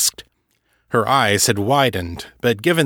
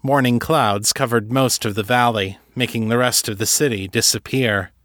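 ordinary delivery

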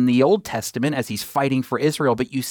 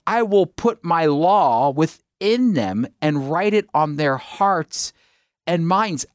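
slow emphatic reading; slower than usual. Acted. Rereads verse to stress it.

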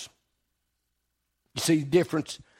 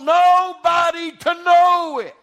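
preaching climax; 8 semitones higher, slower than usual. Acted. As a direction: build it up to a crying-out peak.

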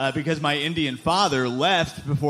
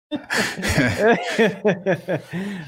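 hearty burst of laughter, pitched up; real laugh; 4 semitones higher than usual. Real, not acted. Reaction to comedy set's punchline.

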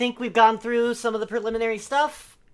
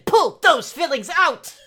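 louder command; excited shout; 5 semitones higher, 6 dB louder than usual. Acted. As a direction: bark the order out. Exclaimed instruction.

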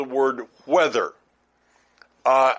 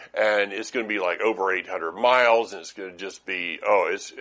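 lightly voiced complainer, low and a bit quick; a hint of a gloomy friend moaning about the trip; negative naysayer; 7 semitones lower than usual. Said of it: "all they do is tell you the negative".

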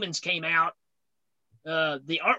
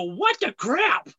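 baffled; 5 semitones higher, much slower than usual. Retold or imitated. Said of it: "see that cover and go".

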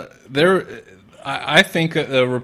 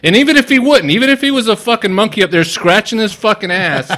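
much higher and louder, worked up; heated rant; 8 semitones higher, 4 dB louder than usual. Real, not acted. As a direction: rant at full force, fired up.

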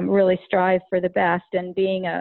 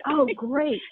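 imitated perking up, pitched higher; lit up, relieved; 6 semitones higher than usual. Mother retells young man hearing who goes next, mock lit up. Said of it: "he kinda lit up".

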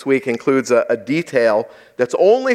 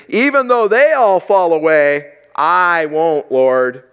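bold Peter; 4 semitones higher, 5 dB louder, slower than usual. Retold or imitated. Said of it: "Peter tells Jesus".

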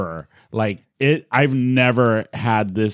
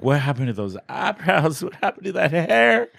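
mocking teasing; 6 semitones higher than usual. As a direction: tease him about his new look. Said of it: "I was like".

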